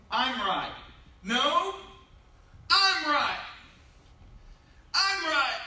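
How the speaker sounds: loud, high insistent shout; mock shouting. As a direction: yell it like a stubborn spouse. Mock stubborn argument.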